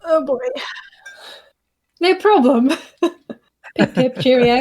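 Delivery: mock posh British. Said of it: "posh British accent".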